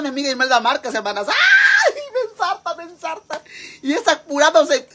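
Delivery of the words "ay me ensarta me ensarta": very high shriek, then squealed words fading; mock screaming woman. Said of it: "Imelda Márquez gritando". (grito agudo fingido que se va apagando)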